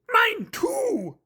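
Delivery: high, loud monster voice; high puppet voice, pleased; delighted Grover. Acted. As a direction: share the joy.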